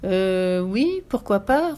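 long hesitant euh, slow rising answer; a bit lost. A narrator's reading. Burglar agreeing to dance with the girl, bewildered. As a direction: agree slowly, unsure and bewildered. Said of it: "reprit Carlson l'éclair un peu perdu".

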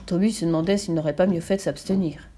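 ordinary delivery

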